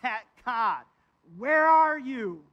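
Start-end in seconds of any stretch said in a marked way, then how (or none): 1.4-2.4 loud, slow, higher cry of outrage; half-scream the question skyward; near scream. Retold or imitated. Preacher voicing David almost screaming at God. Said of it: "He is almost screaming at God".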